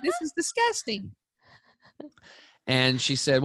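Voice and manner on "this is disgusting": high and squealing with disgust; grossed-out teen. Retold or imitated. Girls throwing brownies down, high revolted whine.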